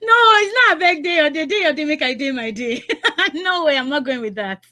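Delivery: high, laughing disbelief; amused refusal. Real, not acted. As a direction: laugh him off the line. Host laughing at caller's bad answer.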